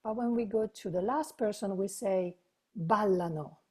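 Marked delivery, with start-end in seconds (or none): 2.7-3.6 "Ballano" stressed BAL-la-no; stress on first syllable. Shown as a demonstration. Teacher showing that ballano stresses its first syllable. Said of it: "The emphasis is, is in the first syllable".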